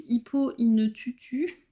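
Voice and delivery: "Hippo in Tutu" read slowly with a French accent; unsure English title. Real, not acted. Showing a new order to viewers, "Hippo in Tutu" read slowly and unsure. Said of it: "je ne pense pas qu'on prononce ça comme ça".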